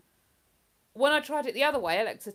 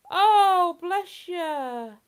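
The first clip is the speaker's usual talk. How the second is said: sympathetic; 9 semitones higher, 10 dB louder, much slower than usual. Real, not acted. Consoling a grieving viewer.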